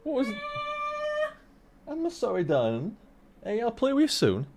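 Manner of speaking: soft, sweet apology; soft placating. Real, not acted. Streamer apologising to darling off-mic, softly placating.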